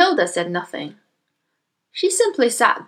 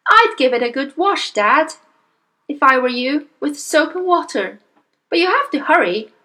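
lightly voiced, higher little girl; Matilda; 6 semitones higher, 3 dB louder than usual. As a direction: advise Dad in a small high voice. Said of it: "Matilda said".

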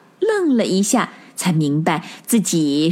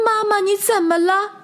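much higher, anxious little voice; alarmed child; 12 semitones higher than usual. A narrator's reading. Little rabbit frightened for its sick mother, high and anxious. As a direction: rush to her in worry.

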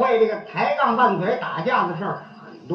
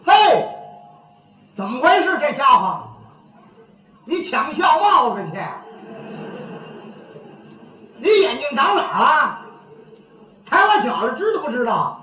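acted angry scolding, pitched up; 开口就骂; 4 semitones higher than usual. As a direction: holler after him and tell him off. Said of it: "跟着就骂". (劈头高声叫骂)

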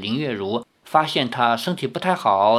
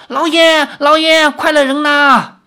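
much louder, higher cry for help; panicked shout; 13 semitones higher, 8 dB louder than usual. A narrator's reading. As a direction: scream for the master in panic. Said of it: "接着便有人叫". (惊慌大喊，呼救)

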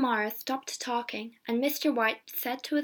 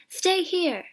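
higher, louder urging; urgent cry; 7 semitones higher, 5 dB louder than usual. A narrator's reading. Soldier urging his friends to stay home, urgent cry. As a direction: cry it out as a warning. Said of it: "he cried".

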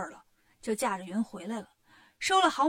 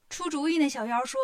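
ordinary delivery, nothing marked

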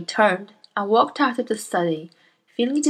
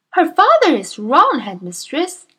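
put-on higher, earnest young teacher voice; Miss Honey; 8 semitones higher, 6 dB louder than usual. Young teacher protests to her headmistress, earnest and higher. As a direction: politely protest to a bully.

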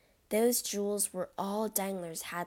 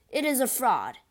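louder, higher angry denial; insisting; 4 semitones higher, 6 dB louder than usual. A narrator's reading. Albert insists to Beauchamp, louder and outraged. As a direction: refuse to believe it. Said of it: "Albert insisted".

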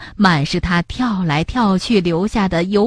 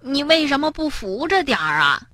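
higher-pitched mild reproach, kept soft; reproachful; 6 semitones higher, 4 dB quieter than usual. A narrator's reading. Tiaotiao to Bengbeng, reproachful. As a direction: scold lightly as a storybook character. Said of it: "跳跳责怪地看着蹦蹦".

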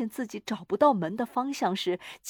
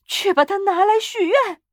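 high, loud, frantic command; shrieked urging; shouted order; 10 semitones higher, 8 dB louder than usual. A narrator's reading. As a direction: yell it as a wild demand. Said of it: "老妇人叫嚷".

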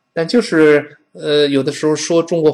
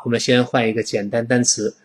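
ordinary delivery

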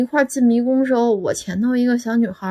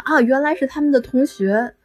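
ordinary talk

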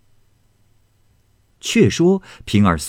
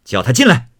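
sharp and loud, higher stern order; stern command; 5 semitones higher, 5 dB louder than usual. A narrator's reading. Fengjie sternly orders the frightened servant brought in. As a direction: bark the order. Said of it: "凤姐儿厉声道".

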